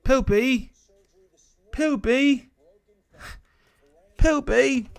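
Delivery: high mock-teasing call; silly high voice. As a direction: call the name in a goofy falsetto. Teasing a chat viewer's name.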